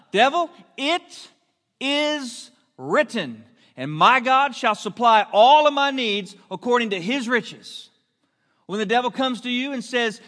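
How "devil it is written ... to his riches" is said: a slow "it is written", then the verse rings out; fiery preaching. Acted. Preacher declaring scripture against the devil, loud and slow. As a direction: declare the scripture with full conviction.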